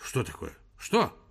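annoyed professor on the phone; irritated. A narrator's reading. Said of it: "раздражился Персиков".

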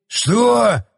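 high-pitched, stretched 'what'; shocked father's exclamation; startled outrage. A narrator's reading. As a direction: jump at it in disbelief. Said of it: "Шелкопряд подпрыгнул на месте".

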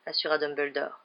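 usual speaking voice

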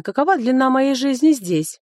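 ordinary delivery

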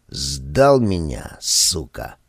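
slow, dropping into a low growl; low bitter curse. A narrator's reading. Мыкин curses in despair. (низкое горькое ругательство)

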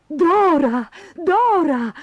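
high, urgent kangaroo cry; calling out. A narrator's reading. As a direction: call the name in excitement. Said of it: "gridò". (grido eccitato di richiamo)

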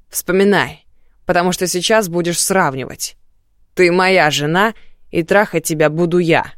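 громко и властно; угрожай, давя голосом; злая угроза. Acted. Муж угрожает жене. (злая угроза)

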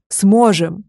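put-on insistent dragon growl at the frightened girl. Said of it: "Последнее слово он буквально прорычал".

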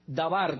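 Dabar said da-BAR, stress on the final A; accent on last syllable. Shown as a demonstration. Preacher teaching the Hebrew word, da-BAR stressed at the end. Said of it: "con acento en la última A".

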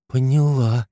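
shaky, frightened whisper; trembling whisper. A narrator's reading. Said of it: "вновь дрожащим шёпотом".

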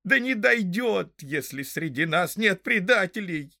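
high, fervent protest; heated exclamation. A narrator's reading. Hob protests to his fellow hobbits, heated and high. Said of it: "горячо воскликнул Хоб".

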